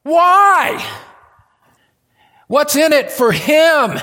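very high, very loud cry of why; insistent questioner. Acted. Preacher voices a probing questioner to the congregation, loud insistent cry. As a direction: cry out the question at full volume. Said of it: "you probe just a little bit more and say".

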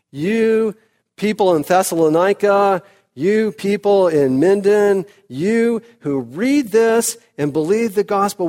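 high, loud preaching build; preaching at full force. Acted. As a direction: proclaim it to the whole church. Preaching to the congregation.

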